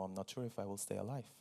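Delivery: ordinary delivery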